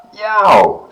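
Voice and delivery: high, loud yelp of pain; pained yow. A narrator's reading. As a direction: yelp as if bitten. Turtle bites pig's tail.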